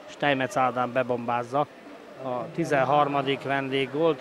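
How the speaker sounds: ordinary talk, nothing marked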